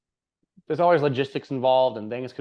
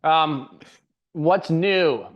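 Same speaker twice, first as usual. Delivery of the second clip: loud, raised podcast opener; yelling; 4 semitones higher, 6 dB louder, much slower than usual. Real, not acted. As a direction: call it out loud and higher. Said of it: "Why am I yelling?".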